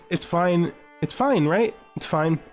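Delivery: normal talking voice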